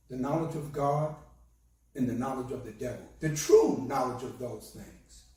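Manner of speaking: normal talking voice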